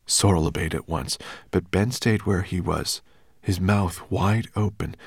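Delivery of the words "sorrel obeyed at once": usual speaking voice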